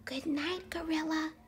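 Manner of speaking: soft unvoiced whisper. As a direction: whisper it so nobody wakes. Mouse says goodnight, gorilla sleeping.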